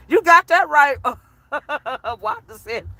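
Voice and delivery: ordinary talk, nothing marked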